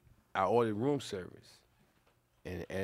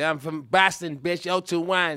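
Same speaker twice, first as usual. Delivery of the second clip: loud, higher mock Boston brag; Boston tough guy; 7 semitones higher, 9 dB louder, slower than usual. Friend mocking Sue's hometown, loud Boston swagger. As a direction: bark it like a proud Boston local. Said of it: "So you say".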